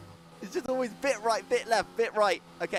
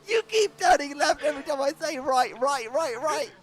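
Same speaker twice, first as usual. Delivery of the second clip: nagging backseat driver; 7 semitones higher, 4 dB louder than usual. Real, not acted. Exasperated at the blindfolded driver.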